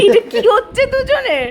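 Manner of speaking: high, loud, drawn-out amused question; real amused squeal; laughing exclamation. Real, not acted. Laughing at the pair's antics.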